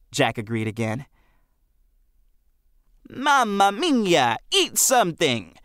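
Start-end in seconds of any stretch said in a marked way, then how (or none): 3.1-5.6 slow, hammed-up Italian accent, mamma mia drawn out. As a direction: play Benny mocking Uncle Freddy with a stretched Italian accent. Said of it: "in his best Italian accent".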